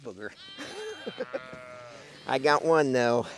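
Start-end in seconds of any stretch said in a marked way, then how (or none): 0.4-2.2 high laugh. Real, not acted. Laughing at the animal.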